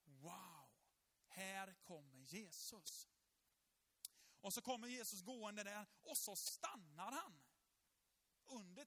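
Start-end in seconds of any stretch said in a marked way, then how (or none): 0.0-3.1 slow, hushed wonder; awed hush. Retold or imitated. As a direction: breathe it out in quiet awe as Jesus approaches. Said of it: "Sackeus där uppe och bara".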